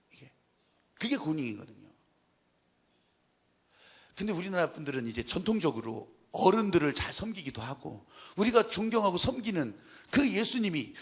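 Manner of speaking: ordinary talk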